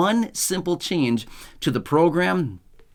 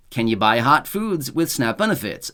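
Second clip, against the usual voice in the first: usual speaking voice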